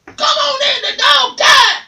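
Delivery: shrill screeching parrot, way up high; high squawking parrot calling out. Acted. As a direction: squawk it like a parrot mimicking a lady. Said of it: "Missus Jones always said".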